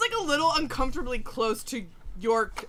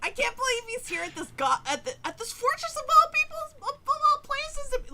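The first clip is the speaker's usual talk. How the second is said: much higher, rushing, breathless gush; gushing goblin fan; 11 semitones higher than usual. Acted. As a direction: squeal like a starstruck fan. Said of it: "he's so excited he can't even talk straight".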